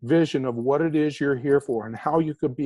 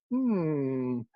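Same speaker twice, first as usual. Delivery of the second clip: soft, drawn-out hum; imitated poker tell; 7 dB quieter than usual. Poker player reacting to a good card, telltale hum. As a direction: hum quietly as if pleased with a card. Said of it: "they go".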